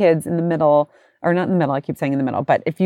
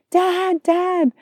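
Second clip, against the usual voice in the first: daughter calling dad; 13 semitones higher than usual. Retold or imitated. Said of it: "Penelope's like".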